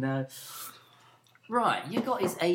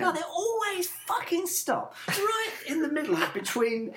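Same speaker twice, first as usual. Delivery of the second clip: exasperated; 8 semitones higher than usual. Real, not acted. Ranting moan about pedestrians.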